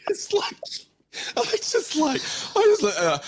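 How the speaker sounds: very high, words squeezed through laughter; can barely talk for laughing; laughing disbelief. Real, not acted. Laughing at bank's offer.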